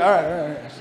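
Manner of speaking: announcer voice. Pretend. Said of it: "Ooh, it gives me shivers".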